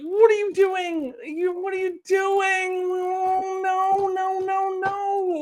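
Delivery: high, drawn-out wail of dismay; despairing plea. Real, not acted. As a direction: whine it slowly in a high pleading voice.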